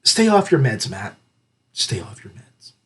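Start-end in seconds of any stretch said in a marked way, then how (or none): 1.7-2.7 conspiratorial whisper to the author. Shown as a demonstration. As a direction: whisper stay off your meds, much softer. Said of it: "All I can say is".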